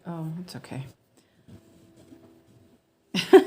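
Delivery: usual speaking voice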